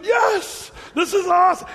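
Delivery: high, loud delight; excited. Real, not acted. Pastor telling the church how he cheered while watching the video. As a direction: cheer it like the best news.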